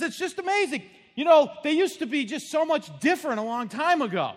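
high, loud mock old man; husband yelling. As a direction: marvel loudly over the TV. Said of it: "He says".

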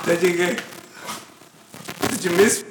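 usual speaking voice

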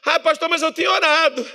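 whiny believer. Retold or imitated. Church member whines an excuse to the pastor. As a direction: imitate a complaining church member. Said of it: "believer's excuse quoted".

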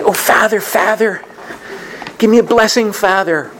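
high, pleading, gushing church lady voice; overly pious woman. Retold or imitated. Pious woman begging the seminarian for a blessing, high and gushing. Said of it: "a very overly pious, overly zealous woman".

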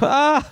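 high knowing ah of recognition; knowing laugh. Retold or imitated. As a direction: laugh like you know the bottle. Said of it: "a knowing laugh when he sees".

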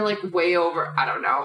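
ordinary talk

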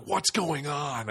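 walk in bewildered by the chaos; mock alarm, stretched out; alarmed father.

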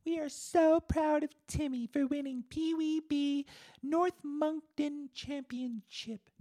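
gushing falsetto proud mom, very high and loud; mom's stock post. Acted. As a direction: read out a canned brag as a mock mother. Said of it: "it was the most stock message".

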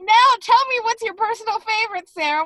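high, loud showman voice; ventriloquist host. Pretend. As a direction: play the ventriloquist working the dummy. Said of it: "I'm your ventriloquist puppet?".

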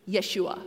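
Yeshua said as "yeh-SHOO-ah"; shortened name form. Shown as a demonstration. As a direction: say Yeshua in three syllables, without the "ho". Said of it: "gets shortened to".

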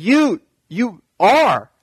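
slow, high, awed stammer; overwhelmed by recognition; excited awe. Retold or imitated. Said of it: "He gets excited".

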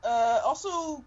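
ordinary delivery

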